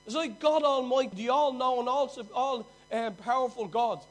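loud, ringing preacher's declaration; full-force preaching. Acted. Preacher telling the congregation God doesn't err, loud. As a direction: preach it out to the whole hall.

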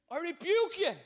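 loud commanding rebuke with full teeth; bold rebuke with teeth. Shown as a demonstration. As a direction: bark out the rebuke, high and loud. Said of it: "It's better with teeth".

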